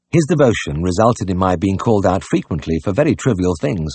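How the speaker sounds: ordinary delivery, nothing marked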